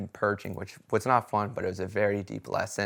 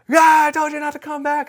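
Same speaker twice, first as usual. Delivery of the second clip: high, loud booming scold as Aya; Aya yelling; 10 dB louder than usual. Retold or imitated. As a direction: shout it down at him like a giant spirit. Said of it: "this big head that's screaming at me".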